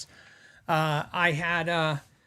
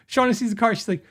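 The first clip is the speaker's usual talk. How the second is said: ordinary talk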